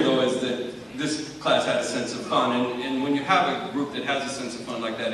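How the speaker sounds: ordinary talk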